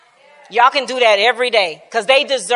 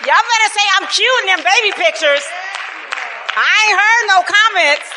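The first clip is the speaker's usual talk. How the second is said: mock demanding; 8 semitones higher than usual. Real, not acted. Speaker to audience about baby pictures, playfully demanding. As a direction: call out for compliments in mock indignation.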